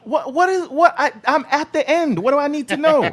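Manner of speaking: squeaky high silly voice; mock clueless voice. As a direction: play dumb in a high squeak.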